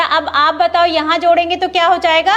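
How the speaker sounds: ordinary delivery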